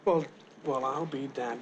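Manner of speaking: ordinary talk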